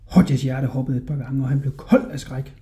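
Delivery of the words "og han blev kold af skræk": ordinary delivery, nothing marked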